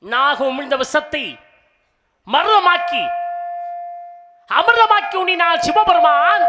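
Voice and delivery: loud, high-pitched ringing proclamation, last words held long; stage declamation. Acted. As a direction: proclaim the temple legend to the whole crowd. Stage declamation of temple legend. (உரத்த மேடைப் பிரசங்கம்)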